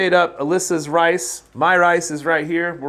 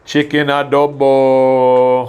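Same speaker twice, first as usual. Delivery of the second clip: slow, loud, drawn-out presentation; slow grand announcement; 7 dB louder, much slower than usual. Pretend. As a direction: unveil it like a game-show prize. Presenting the dish with fanfare.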